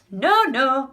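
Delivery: loud, high refusal; yelling back. A narrator's reading. Wonka refuses the frightened grandparents, yelling back. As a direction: yell it back over the din. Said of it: "Mister Wonka yelled back".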